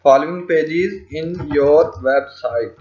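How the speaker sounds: measured, drawn-out reading while writing; slow dictation. Shown as a demonstration. Tutor typing the page request for learners, slow dictation. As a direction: say each word slowly as you write. Reading out what is being typed.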